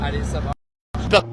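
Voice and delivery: choppy, cutting-out signal; words breaking up on a bad stream; tape dropouts. Real, not acted. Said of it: "désolé pour la petite coupure de, de réseau".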